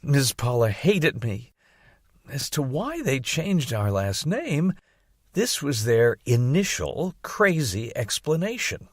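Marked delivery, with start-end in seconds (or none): none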